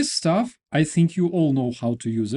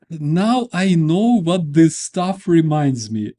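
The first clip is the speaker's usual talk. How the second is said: fighting through the giggles; real laughter leaking through; laughing; 4 semitones higher, 7 dB louder than usual. Real, not acted. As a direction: still laughing, slow and loud. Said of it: "I'm hysterical".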